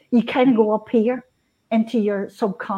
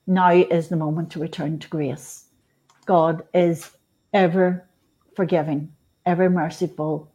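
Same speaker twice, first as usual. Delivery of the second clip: lowered centering voice; 3 semitones lower than usual. Shown as a demonstration. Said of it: "just lower my voice".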